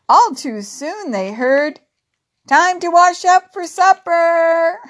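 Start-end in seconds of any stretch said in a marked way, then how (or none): 2.5-4.8 high, loud supper call. Acted. Calling the gang to supper, high and loud. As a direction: call the children in from play.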